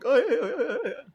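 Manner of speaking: high, loud string of panicky yelps; nervous babbling. Retold or imitated. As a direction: imitate your own jittery nerves, hammed-up. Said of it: "I was very nervous".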